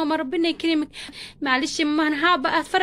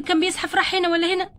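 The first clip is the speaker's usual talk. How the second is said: usual speaking voice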